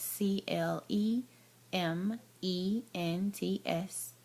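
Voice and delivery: slow letter-by-letter spelling; spelling out slowly. Real, not acted. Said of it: "spelling name slowly".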